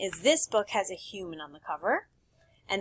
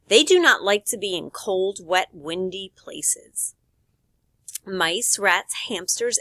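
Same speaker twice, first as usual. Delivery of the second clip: reading aloud; 5 dB louder than usual. Acted. Reads the pet book aloud.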